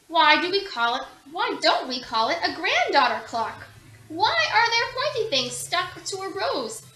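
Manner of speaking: bright breathless little questioner; eager child questions. A narrator's reading. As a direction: rattle off curious whys. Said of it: "she simply asked".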